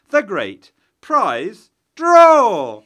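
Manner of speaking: drawn out, loud and high hype; excited announcer. Real, not acted. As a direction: stretch it out like a game show host. Hyping the prize draw.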